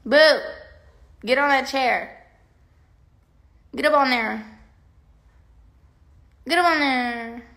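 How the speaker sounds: loud, high calls to the dog; calling the dog. Real, not acted. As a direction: call him up onto the chair. Calling dog onto chair.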